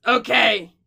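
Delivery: high, loud, quick squeaky kobold apology; a little creature babbling sorry in a rush; squeaky nervous kobold voice; apologetic kobold. Acted. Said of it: "Kobold goes".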